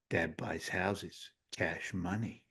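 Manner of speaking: low, slow, quiet deadpan; deadpan toddler son. Retold or imitated. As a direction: imitate a small boy stating it dead seriously. Toddler son's deadpan answer, retold.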